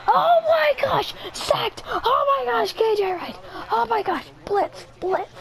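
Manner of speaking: high-pitched, thrilled gamer reaction; real burst of high excitement; excited outcry. Real, not acted. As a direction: cry it out high as the sack lands. Reacting to a sack in game.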